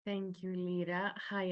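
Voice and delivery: ordinary delivery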